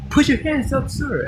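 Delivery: high-pitched mock cop order; imitated police command. Acting out cop kicking in door.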